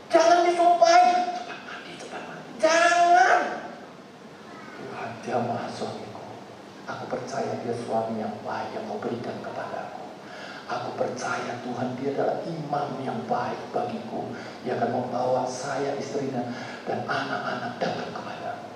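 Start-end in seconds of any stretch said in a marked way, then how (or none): 1.3-2.5 hissed command to hurry; whispered nagging. Retold or imitated. Istri menyuruh suami. 4.8-18.7 softer, lower tender prayer; soft earnest prayer. Retold or imitated. Istri mendoakan suami dengan lembut.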